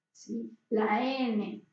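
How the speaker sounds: ordinary talk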